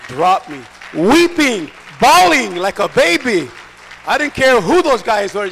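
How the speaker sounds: impassioned preaching, loud bursts. Real, not acted. Preacher telling how he dropped to his knees weeping, impassioned preaching. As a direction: cry it out with full preacher force.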